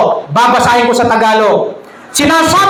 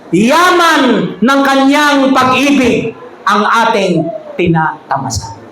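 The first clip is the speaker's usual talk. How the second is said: slow emphatic preaching; 3 semitones lower, slower than usual. Real, not acted. Preacher stretching key line.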